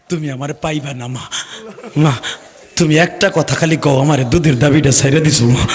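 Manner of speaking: low, mournful farewell to mother; subdued last words of a son; pleading son. Retold or imitated. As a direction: plead sorrowfully, sinking at each "ma". Doomed son's last plea to mother.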